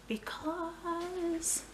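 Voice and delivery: usual speaking voice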